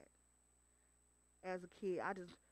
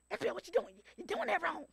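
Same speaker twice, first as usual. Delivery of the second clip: dad's voice; 8 semitones higher than usual. Retold or imitated. Dad scolding April while cooking.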